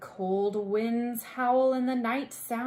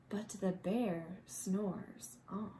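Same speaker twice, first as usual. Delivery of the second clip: soft sleepy refrain; 4 semitones lower, 8 dB quieter than usual. A narrator's reading.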